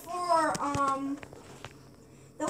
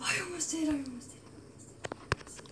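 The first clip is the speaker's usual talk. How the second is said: fading to a whisper; 9 dB quieter, faster than usual. Real, not acted.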